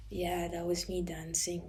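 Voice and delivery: imitating a child's bad Irish accent; fake Irish accent. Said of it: "started pretending she was Irish".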